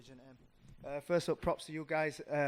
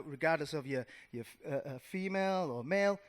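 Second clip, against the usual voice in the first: normal talking voice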